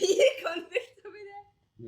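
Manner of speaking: high, loud, slow laughing echo. Real, not acted. Amused friend echoing the convicts jibe, laughing. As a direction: repeat the jibe through a big laugh.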